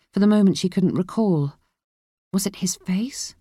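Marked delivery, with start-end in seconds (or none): 2.3-3.3 breathy whispered question to herself; whispered thought. A narrator's reading.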